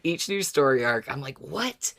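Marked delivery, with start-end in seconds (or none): none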